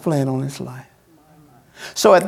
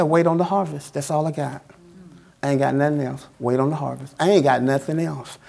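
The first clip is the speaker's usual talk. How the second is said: quiet, low voice of God; 6 semitones lower, 7 dB quieter than usual. Acted.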